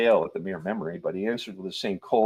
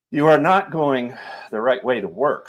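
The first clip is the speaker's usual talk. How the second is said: severe; 5 semitones higher, 5 dB louder than usual. A narrator's reading. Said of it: "he said with severity".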